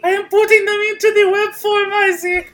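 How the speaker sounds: very high, loud squeaky voice. Acted. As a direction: squeal like a tiny machine. Voices machine buying the movie.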